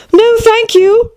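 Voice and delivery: put-on high, slow, girlish refusal; polite Alice. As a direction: decline carefully in a high voice.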